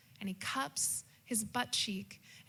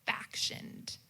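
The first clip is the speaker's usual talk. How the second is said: whisper; 4 dB quieter than usual. Acted. Secret family confession.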